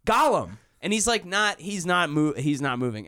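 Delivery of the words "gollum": raised, urgent calls to a passed-out friend; mild alarmed yelling; calling out. Retold or imitated. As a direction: call his name, alarmed.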